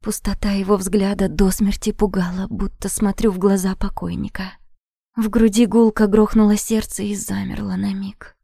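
ordinary delivery

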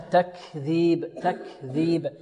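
تكذيب softly, with air after the k; breathed kaf. Shown as a demonstration. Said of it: "الكاف هنا مهموسة". (همس الكاف في تكذيب)

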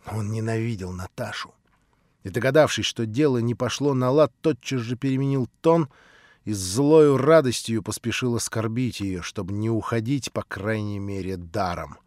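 usual speaking voice

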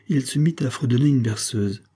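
ordinary delivery, nothing marked

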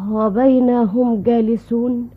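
ordinary delivery

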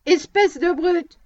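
slow, loud angry shout; hitting the brute while yelling, slow and loud. A narrator's reading. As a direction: spit it out slowly, full volume. Punching a man down.

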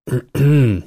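pointed little throat-clear. Acted. Barman interrupting two people too close, clearing his throat. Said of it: "Бармен откашлялся".

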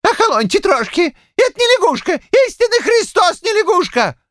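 put-on very high, flustered old man protesting; frightened insistent cries. A narrator's reading. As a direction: cry out stubbornly in high-pitched panic. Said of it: "растерявшийся и перепуганный щукарь упорно выкрикивал". (перепуганно и упорно выкрикивает, высоко)

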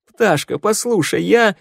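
high, pleading, voice cracking; voice breaking. A narrator's reading. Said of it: "Голос нежителя сорвался".

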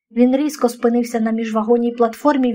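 ordinary delivery

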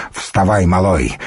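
ordinary delivery

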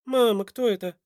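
put-on high-pitched child's question. A narrator's reading. Boy asks his mother about the stranger, childlike and high.

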